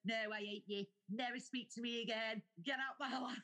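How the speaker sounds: high, hammed-up dramatic rejection; mock drunken rant. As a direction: imitate a melodramatic drunk falling out. Acted drunken rant at Kyle.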